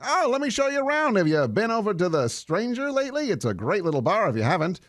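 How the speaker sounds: high, quick, chatty welcome; friendly local. Acted. Drow welcoming a newcomer to town, high and chatty. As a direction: play the over-friendly neighbourhood guide.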